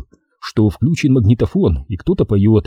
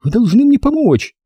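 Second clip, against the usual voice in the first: high-pitched frantic female plea; desperate woman, pitched far up; hysterical pleading; 14 semitones higher than usual. Acted. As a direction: beg on a hysterical note. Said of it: "Голос молил на истерической ноте".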